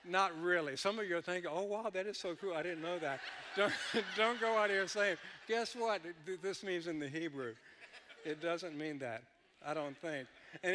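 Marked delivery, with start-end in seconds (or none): 1.5-3.2 higher, louder mimic of an impressed listener; mock amazement. Mimics gullible listeners being impressed. 5.5-7.5 high, loud mimic of someone sharing news; mock excitement. Mimics listeners spreading the false fact.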